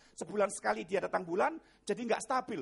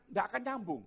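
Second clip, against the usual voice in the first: usual speaking voice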